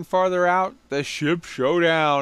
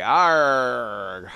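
pirate growl; 4 semitones lower than usual. Pretend. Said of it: "Talk Like a Pirate Day".

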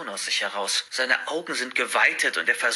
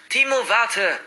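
high-pitched call down the corridor; calling after; 10 semitones higher, 3 dB louder, slower than usual. A narrator's reading. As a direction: call out to stop a friend. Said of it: "ruft er mir hinterher".

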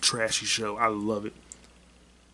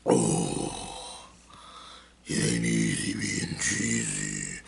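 much lower and slow, like a slowed tape; slowed-down deep voice; much slower than usual. Pretend. Vlogger joking in a slowed deep pitched-down voice. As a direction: drag it out in a deep slowed drawl.

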